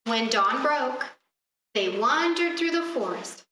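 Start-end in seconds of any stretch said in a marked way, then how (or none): none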